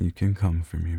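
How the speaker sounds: ordinary talk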